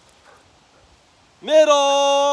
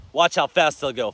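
ordinary talk, nothing marked

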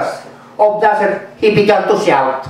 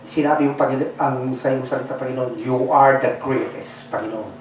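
low, soft and hushed prayer voice; 8 semitones lower, 5 dB quieter than usual. Acted. Pastor praying over the congregation, hushed and low. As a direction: pray softly over the church. Pastor prays before the sermon.

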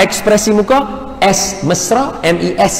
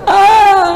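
wail; 3 dB louder than usual. Retold or imitated. End of retold quarrel.

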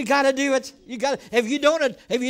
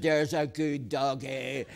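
much lower, slow, soft cooing; cooing wife voice; 10 semitones lower, 4 dB quieter, slower than usual. Retold or imitated. Wife Laura soothing the wild dog, soft cooing. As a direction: coo gently to the dog. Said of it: "She said".

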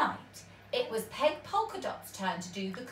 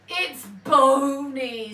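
slow, louder complaint; disgusted; 3 semitones higher, 7 dB louder than usual. A narrator's reading. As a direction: grimace at the bony soup. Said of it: "said Ben Buckle".

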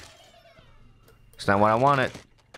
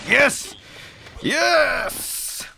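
triumphant yes. Real, not acted. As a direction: cheer the win. Pig finally falls.

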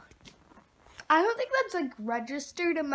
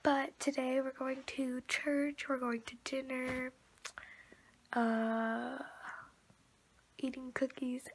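soft unvoiced whisper to the camera; hushed vlog whisper; 2 semitones lower, 8 dB quieter than usual. Real, not acted. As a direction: whisper so no one wakes. Quiet morning vlog whisper.

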